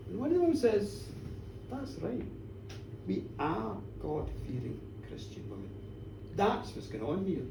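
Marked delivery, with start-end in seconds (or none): 1.7-7.4 low, soft, self-righteous reply; quiet defiance. A narrator's reading. Village woman justifying the attack to minister, quiet defiance. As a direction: answer the minister back in a hushed voice.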